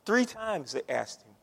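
ordinary talk, nothing marked

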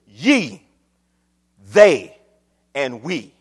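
"Ye", "they", "and we" set apart by pauses; each of "Ye, they, and we" landed separately; emphatic single words. Acted. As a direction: punch "Ye" and "they" low and hard. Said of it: "is emphatically spoken".

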